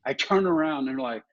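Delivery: ordinary talk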